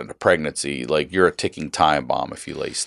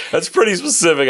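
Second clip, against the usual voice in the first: doctor's dry reply; 13 semitones higher, faster than usual. Retold or imitated. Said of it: "He goes".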